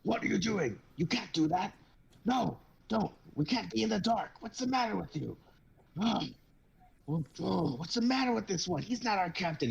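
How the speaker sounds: high, frantic pleading; panicked old man. Acted. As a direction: beg them not to put out the lights. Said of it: "an old man runs up to him and goes".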